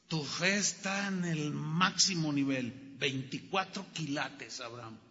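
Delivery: the angel tenderly commending Abraham's faith; gentle praise. Acted. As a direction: praise him quietly and warmly.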